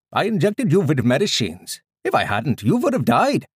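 put-on high, loud Indian-accented doctor; thick Indian accent. A narrator's reading. Doctor justifying treatment to his patient, high emphatic Indian accent. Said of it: "he said in a thick Indian accent".

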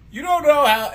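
high, loud scolding parent; lecturing parent. Acted. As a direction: start into an indignant dad rant. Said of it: "And I'd be like".

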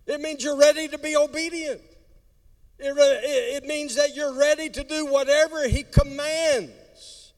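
loud, high preaching; preaching at full force. Acted. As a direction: preach it hard.

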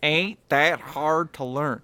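put-on slow, drawn-out Southern US accent; mock Southern drawl. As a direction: drag the vowels like a Southern speaker. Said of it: "throw the Southern States underneath".

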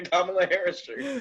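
high, mock-friendly recommendation; cutesy app voice. Twitter recommending an awful video to a user, mock sweet.

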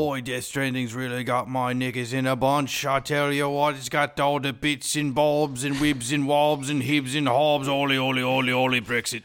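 slow, hammed-up British gibberish; mock British accent. Shown as a demonstration. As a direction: roll through it in a cartoon British accent. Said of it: "our British accents are better than".

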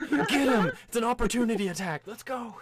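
high, loud battle cry; rallying cry. Acted. Party spotting an opening on the boss, shouted charge. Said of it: "everyone's like".

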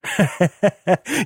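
put-on old man's gloating chuckle. A narrator's reading. Father grinning over his captive son, gloating chuckle. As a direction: chuckle in triumph.

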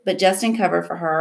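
plain reading voice

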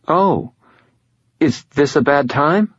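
high, tentative female caller; hesitant woman. A narrator's reading. Woman sensing she has annoyed him, hesitant high voice. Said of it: "an odd hesitancy to them".

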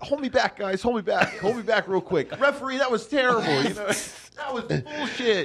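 put-on a kid begging to be held back; mock angry player. Mimicking Pat's mock rage.

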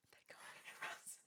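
barely audible, voiceless whisper. Retold or imitated. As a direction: whisper it under your breath. Retelling hushed panicked aside in lift.

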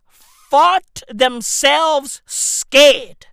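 ordinary delivery

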